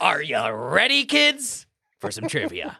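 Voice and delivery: slow, rising pirate host call; SpongeBob pirate. Pretend. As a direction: hype the kids like the cartoon pirate. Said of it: "the pirate at the beginning of SpongeBob".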